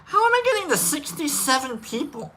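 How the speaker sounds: high-pitched put-on incredulous voice; mock bewilderment. Host questions his audience size, high mock-bewildered voice. Mock question about sixty-seven people present.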